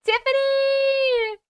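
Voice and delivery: high, slow, sing-song call of a name. Real, not acted. Streamer happily calling out a viewer's name in chat, high and drawn out. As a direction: stretch the name out like calling across a room.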